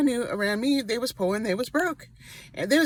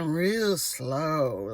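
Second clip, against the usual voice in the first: a mock slow Texas-style delivery, lower; slow Texas talk; 5 semitones lower than usual. Recalling the Texas preacher on TV, slow mock drawl. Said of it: "it's Texas kinda accent".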